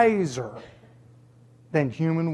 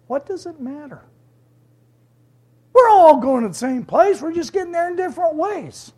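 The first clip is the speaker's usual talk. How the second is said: higher, breezy mocking voice of a shrugging believer; mock careless attitude; 10 semitones higher, 4 dB louder than usual. Preacher mimicking a careless believer's dismissive shrug. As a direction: toss it off like it doesn't matter. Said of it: "That's a very dangerous attitude".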